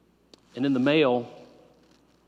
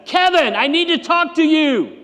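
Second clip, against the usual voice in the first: much higher, louder yell of his name; 15 dB louder than usual. Retold or imitated. Front desk gal yelling after the pastor who ignores her, loud yell. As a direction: shout it across the lobby to stop him. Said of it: "she finally yelled at me".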